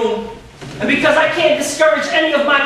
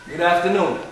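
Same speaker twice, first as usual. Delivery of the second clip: a much lower, quieter, formal greeting; princely calm; 8 semitones lower, 5 dB quieter than usual. Retold or imitated. African boy greeting his teacher with princely calm. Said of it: "calm, collected, like a prince".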